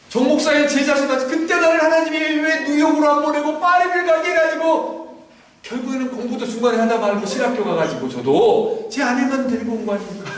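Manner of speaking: ordinary talk, nothing marked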